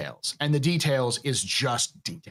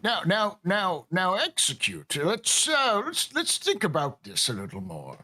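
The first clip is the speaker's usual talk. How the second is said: put-on old man, pitch swinging up; 7 semitones higher, slower than usual. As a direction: play the old man, drawn out at first. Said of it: "I'm trying to do an old man accent".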